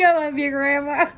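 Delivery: high, teary, choked voice; crying thanks. Real, not acted. Telling grandma she loves her while crying. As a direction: thank her through real tears.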